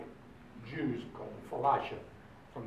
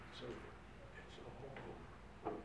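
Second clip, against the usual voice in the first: much softer, slow, nearly whispered; hushed comforting; 15 dB quieter, slower than usual. Retold or imitated. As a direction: lull him back to sleep. Wife soothing him at night.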